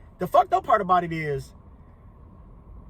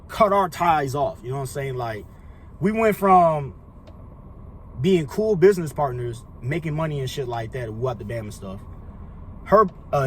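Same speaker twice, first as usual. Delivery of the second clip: usual speaking voice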